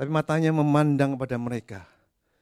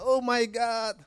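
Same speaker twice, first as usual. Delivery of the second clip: higher mock cry of fear; imitated scared "Oh my God", pitched up; frightened cry; 9 semitones higher, much slower than usual. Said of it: "Sometimes kita berteriak".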